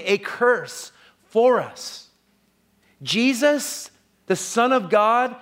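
high, emphatic sermon voice, drawing out key phrases; forceful preaching. Acted. Preacher marvelling at Christ becoming a curse, forceful and high.

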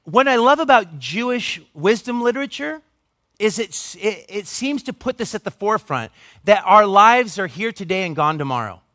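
normal talking voice

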